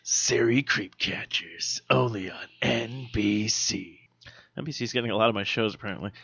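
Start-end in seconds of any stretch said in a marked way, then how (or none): 0.0-4.0 slow, soft announcer tag line; mock TV promo. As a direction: purr it like a network promo.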